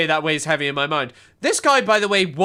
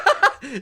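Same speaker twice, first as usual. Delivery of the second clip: high burst of laughter; real amused laugh; 8 semitones higher than usual. Real, not acted. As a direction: crack up, high-pitched. Amused at own joke over clip.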